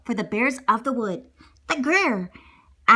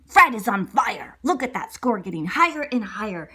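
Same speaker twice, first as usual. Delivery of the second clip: ordinary delivery, nothing marked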